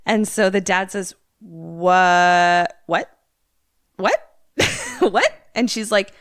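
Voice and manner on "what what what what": rising incredulous whats, some louder; mimicking shocked disbelief; stunned whats. Dad hearing the kid is home, rising shocked whats.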